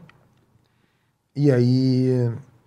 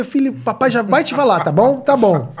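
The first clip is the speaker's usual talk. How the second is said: higher, placating father voice; mock patient dad; 7 semitones higher than usual. Dad brushing off son's question, placating. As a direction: soothe the kid to get back to the game. Father voicing himself to child.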